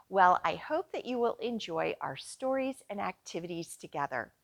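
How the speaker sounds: ordinary talk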